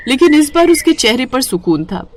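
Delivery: usual speaking voice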